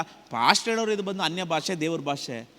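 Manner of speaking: ordinary delivery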